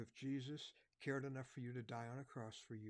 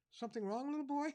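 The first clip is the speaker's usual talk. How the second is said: higher, louder kindly cop voice; friendly officer calling to a lost boy; policeman; 13 semitones higher, 7 dB louder than usual. Retold or imitated. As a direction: play the helpful policeman.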